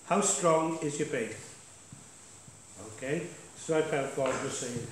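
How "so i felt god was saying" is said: usual speaking voice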